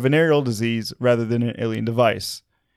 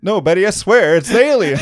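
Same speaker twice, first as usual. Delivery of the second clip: high, loud laughing plea; mock pleading husband; 6 semitones higher, 7 dB louder than usual. Barney swearing to Betty about aliens, high laughing plea. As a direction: plead with your wife through laughter.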